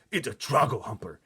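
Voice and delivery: loud shout of fury and anguish; furious shout. A narrator's reading. Giant names the nightmare to Sophie, furious shout. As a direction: bellow the name in dismay. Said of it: "he shouted".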